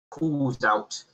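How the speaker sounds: ordinary talk, nothing marked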